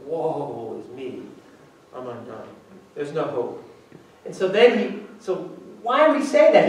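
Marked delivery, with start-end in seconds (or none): 0.0-3.6 hopeless lament. Shown as a demonstration. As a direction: sigh out the ruin of it. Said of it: "This is like".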